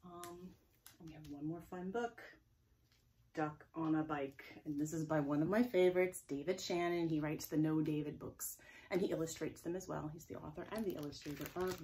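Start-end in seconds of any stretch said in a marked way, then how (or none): none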